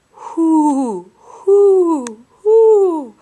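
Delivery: loud, rising owl hoots; cheerful owl hooting from a branch; happy owl hoot. Acted. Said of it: "in a happy voice".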